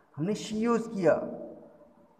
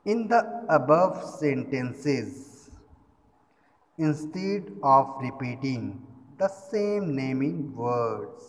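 slow, drawn-out dictation reading; 4 dB louder, much slower than usual. Acted. Teacher dictating the definition slowly to her class. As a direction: read it out slowly so students can write.

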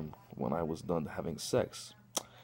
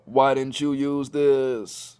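mock cousin voice; 4 semitones higher, 6 dB louder, much slower than usual. Cousin confronting him.

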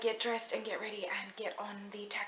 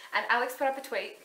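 excited; 8 dB louder than usual. Real, not acted. Excited recounting.

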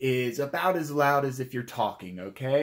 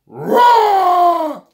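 huge, high, long roar; dinosaur roar; 18 dB louder than usual. Shown as a demonstration. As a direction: roar at full volume like a dinosaur. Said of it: "roar like a dinosaur".

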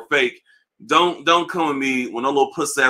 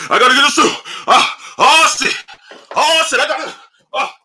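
much higher, louder mock hype about a shoe; mock hyped YouTuber; 10 semitones higher, 4 dB louder than usual. Sneaker YouTuber doing the most on camera, high mock hype. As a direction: overdo the frantic excitement of a YouTuber. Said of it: "Sneaker YouTubers don't help when they're doing all the most".

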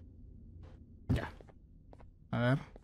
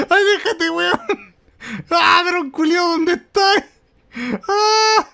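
much higher, much louder, laughing through the terror; panicked laughing screams; 12 semitones higher, 7 dB louder than usual. Real, not acted. Lost his drone while the bird attacks, shrieking.

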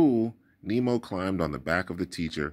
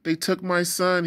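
high, loud pleading; father crying out to strangers for his lost son; desperate cry; 9 semitones higher, 4 dB louder than usual. Acted. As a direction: beg passers-by for help. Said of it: "he cried".

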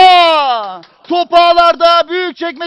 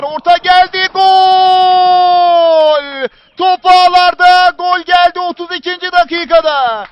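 screaming football commentator; 33 dB louder, much slower than usual. Real, not acted. Goal call in live commentary.

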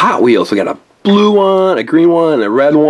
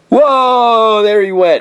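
excited; 7 semitones higher, much slower than usual. Acted. Toy spider attack.

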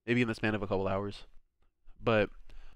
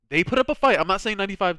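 high, loud insistence; emphatic; 8 semitones higher, 7 dB louder than usual. Real, not acted. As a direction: insist loudly, pitch jumping up. Defending a kingdom's effort.

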